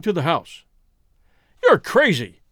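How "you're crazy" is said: loud, furious outburst; fierce cry. A narrator's reading. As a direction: shout it at them in fury. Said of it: "he cried fiercely".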